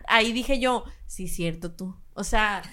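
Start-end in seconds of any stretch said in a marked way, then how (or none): 1.1-2.0 mock meek reply. Mocking soft reply.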